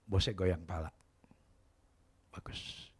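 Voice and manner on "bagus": whispered praise. Retold or imitated. Boss whispering approval to the maid. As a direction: breathe the word out.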